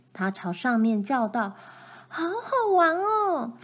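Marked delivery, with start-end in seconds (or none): 2.1-3.6 high, slow, delighted call; girl rolling in the straw calls up, high and delighted; gleeful call. A narrator's reading. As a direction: call up full of fun. Girl shouting joyfully while rolling.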